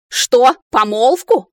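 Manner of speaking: громкий возмущённый переспрос; взреви от возмущения; гневный рёв. A narrator's reading. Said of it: "взревел блондинистый маг". (возмущённый рёв)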